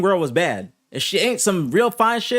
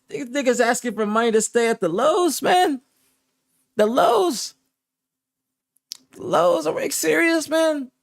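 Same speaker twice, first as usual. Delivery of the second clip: high, incredulous outrage; outraged disbelief; 9 semitones higher than usual. Real, not acted. Streamer ranting at his team about the hotel, high outrage. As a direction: throw up your hands at the Lowe's booking.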